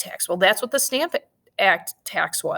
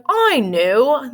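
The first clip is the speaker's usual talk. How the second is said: higher, louder mock British accent; terrible British accent; 5 semitones higher, 5 dB louder than usual. England hatching its plan to pay for the war, terrible British accent. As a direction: put on a badly faked British voice. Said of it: "That's my terrible British accent".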